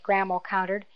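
plain reading voice

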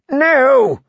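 high shouted no; shouting. A narrator's reading. Prisoner shouting at the slammed door. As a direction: shout it out in despair. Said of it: "he shouted".

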